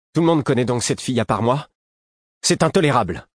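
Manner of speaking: high, indignant outburst; exasperated. A narrator's reading. Prince learning a secret was kept from him, violently exasperated. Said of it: "une violente exaspération".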